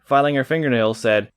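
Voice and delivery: ordinary delivery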